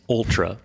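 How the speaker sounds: "Ultra" with a rounded first vowel, like "ole-tra"; front-of-mouth Ultra. Shown as a demonstration. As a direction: say "Ultra" starting like "old". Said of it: "Ultra's more in the front".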